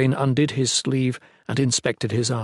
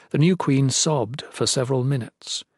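plain reading voice

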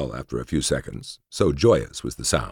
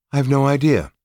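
high, louder, cool dismissal; 11 semitones higher, 5 dB louder than usual. A narrator's reading. Laya disowns any connection with the other woman, coolly. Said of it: "she replied coolly".

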